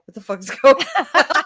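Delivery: loud mock disbelief breaking into laughter; laughing bafflement. Two introverts watching from the table, loud laughing disbelief. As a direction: blurt it out, cracking up midway.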